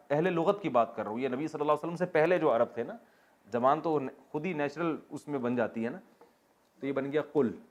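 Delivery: ordinary talk, nothing marked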